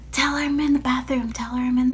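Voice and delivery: whispering to friend on the phone with mom; urgent whisper. Retold or imitated. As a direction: whisper fast so mom won't hear. Whispering so mom won't hear.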